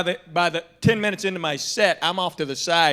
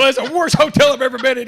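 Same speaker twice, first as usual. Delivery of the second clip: loud, fast, much higher, laughing through it; laughing shout; 10 semitones higher, 10 dB louder, faster than usual. Retold or imitated. Comic telling another comic about the worst hotel, laughing shout. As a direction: blurt it out with a laugh.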